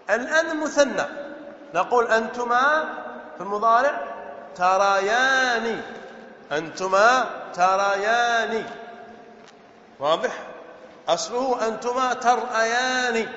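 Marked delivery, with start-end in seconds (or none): 4.5-8.6 slow "تريان" with long held vowels; drawn-out model form. Shown as a demonstration. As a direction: stretch "تريان" out for the class. Teacher models the dual form. («تريان» بمدّ طويل وبطء)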